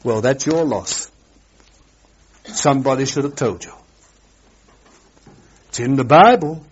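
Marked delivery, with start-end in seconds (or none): none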